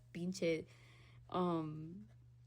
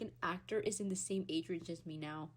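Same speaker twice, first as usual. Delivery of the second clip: usual speaking voice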